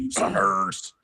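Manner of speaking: gruff ninja growl; Scorpion voice. Retold or imitated. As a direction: sneer it like Scorpion. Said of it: "in a Scorpion voice calls them suckers".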